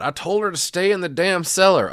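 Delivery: high, loud imitation of an irritated shout; mock yell. Howard complains after shooting Lorraine, imitated as an exasperated yell. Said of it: "We hear Howard yell out".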